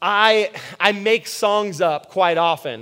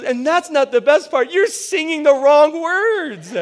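mocking amused wife; 6 semitones higher than usual. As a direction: draw out the punchline like she did. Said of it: "And she goes".

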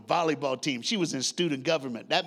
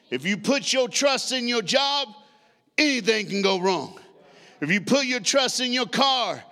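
higher, rising toward a shouted close; building preaching cadence; 6 semitones higher, 4 dB louder than usual. Acted. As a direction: build each line to the payoff.

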